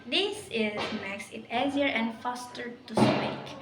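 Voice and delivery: ordinary talk, nothing marked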